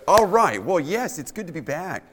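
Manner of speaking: ordinary talk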